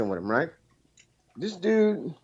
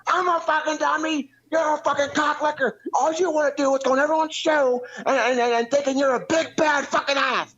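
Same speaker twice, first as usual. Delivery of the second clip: much higher mock rant at a buddy; imitating angry friend; 12 semitones higher than usual. Caller imitating his friend Bullfrog telling him off, high mock rant. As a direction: whine out his friend's furious brush-off, pitched way up.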